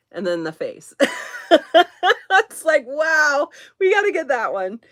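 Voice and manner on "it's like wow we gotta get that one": high, loud delighted reaction; excited retelling. Retold or imitated. Shopper re-enacting the moment they saw the vase, excited. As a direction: re-enact being wowed, voice lifted.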